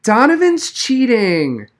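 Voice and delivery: high, slow, loud tattling wail; bawling girl. A narrator's reading. Abigail calling the teacher to report cheating, bawling. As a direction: bawl out for the teacher. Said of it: "she bawled".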